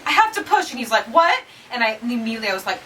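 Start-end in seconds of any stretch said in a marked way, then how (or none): 0.1-0.7 high, urgent cry to push; woman in labor urgently telling husband; urgent plea. Retold or imitated. 1.1-1.4 high, louder startled what; husband startled by the news. Retold or imitated.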